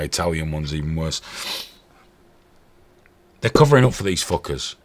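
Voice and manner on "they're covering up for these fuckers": ordinary talk, nothing marked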